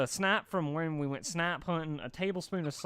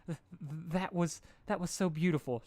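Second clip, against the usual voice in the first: sobbed; 5 semitones higher than usual. A narrator's reading. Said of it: "she sobbed".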